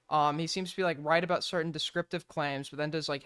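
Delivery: ordinary delivery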